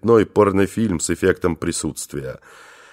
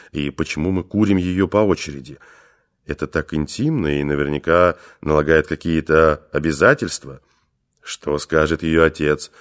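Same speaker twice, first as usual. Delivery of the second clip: plain reading voice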